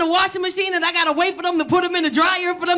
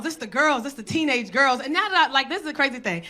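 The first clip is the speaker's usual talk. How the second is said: usual speaking voice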